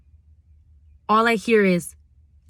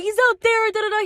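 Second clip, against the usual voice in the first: frantic; 12 semitones higher, faster than usual. Retold or imitated. Panicked retelling to roommate.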